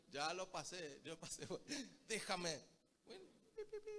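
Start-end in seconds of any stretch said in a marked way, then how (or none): 2.1-2.6 a loud, exasperated "Déjame"; mock wife's loud "leave me". Preacher quotes an upset wife telling her husband to leave her, loud and mock-exasperated. 3.1-3.9 a high, squeaky shooing "pi, pi"; mock squeaky voice. Preacher mimics the husband being shooed off, high and squeaky.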